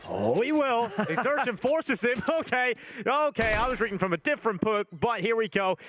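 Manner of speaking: high laughing surprise. Real, not acted. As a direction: laugh off a wrong call. Caster caught out, laughing.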